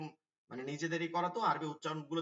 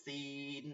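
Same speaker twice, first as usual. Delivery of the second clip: "সিন" with a long ee, softer and held; stretched vowel; 2 semitones lower, 9 dB quieter, much slower than usual. Shown as a demonstration. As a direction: draw out the middle of "সিন". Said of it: "একটু টান দিয়ে হবে". (নিচু স্বরে টান দিয়ে "সিন")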